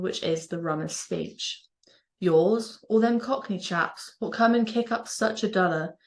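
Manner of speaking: broad Norfolk dialect, slow recital; Norfolk accent. Shown as a demonstration. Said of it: "a poem written in my mother language, the Norfolk dialect".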